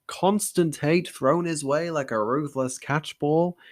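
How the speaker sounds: ordinary delivery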